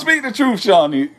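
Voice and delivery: high, loud mock exhortation; playful egging-on. Man teasing Shawnee to speak the truth, playful egging-on. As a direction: egg her on in a high, playful cry. Teasingly urging her to confess.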